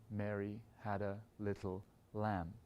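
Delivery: slow build through the line into "lamb"; slow, stress on LAMB. Shown as a demonstration. Conductor sings a little crescendo into the lamb. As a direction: grow slowly toward "lamb" and land it. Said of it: "a little crescendo into it".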